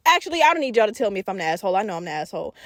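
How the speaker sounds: ordinary delivery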